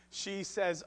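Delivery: ordinary talk, nothing marked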